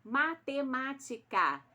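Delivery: "Matemática" slow and loud, each syllable distinct. Shown as a demonstration. Teacher summing up the count for pupils, "Matemática" in measured syllables. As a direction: join the five beats of "Matemática" slowly. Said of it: "Tem cinco sílabas".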